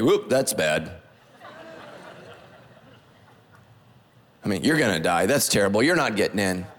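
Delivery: low, dismissive priest with a chuckle; mocking bored priest. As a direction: play the lazy priest shrugging people off. Bored priest inspecting lepers mocked.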